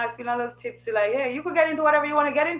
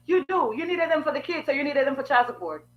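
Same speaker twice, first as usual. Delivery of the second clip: ordinary talk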